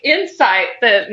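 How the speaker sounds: ordinary talk, nothing marked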